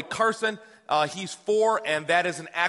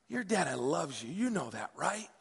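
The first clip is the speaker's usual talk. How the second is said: soft, slow fatherly plea; tender reassurance; 3 semitones lower, 8 dB quieter than usual. Retold or imitated. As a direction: hush down and gently check he believes it. Dad pleading tenderly with son.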